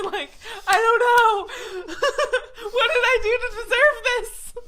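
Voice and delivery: high, loud words broken by real laughter; laughing. Real, not acted. Laughing to a friend about being blacklisted. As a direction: laugh through the question in disbelief.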